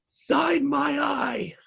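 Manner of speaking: very high, drawn-out silly squeal; hammed-up fan begging for an autograph; star-struck fan. Joking as a fan meeting a celebrity, squealing plea.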